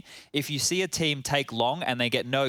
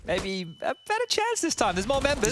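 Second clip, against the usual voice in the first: excited; 10 semitones higher than usual. Real, not acted. Caster hypes a live play.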